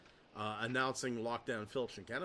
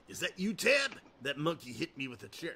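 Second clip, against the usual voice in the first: Randy Savage impression. Shown as a demonstration. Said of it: "Not my best one".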